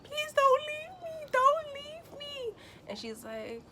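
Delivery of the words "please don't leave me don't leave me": much higher, drawn-out, slow wailing plea; desperate plea. Retold or imitated. Patient in labor begging the doctor to stay, high drawn-out wail. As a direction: beg her not to go, high and slow. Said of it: "I screamed".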